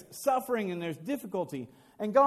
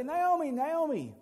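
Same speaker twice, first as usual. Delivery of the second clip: higher, slow drawn-out calling of the name; joyful calling; 7 semitones higher, slower than usual. Acted. Women running out to greet Naomi, joyful calling. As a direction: call her name across the square. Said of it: "they run out and they say".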